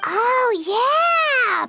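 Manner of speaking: ordinary delivery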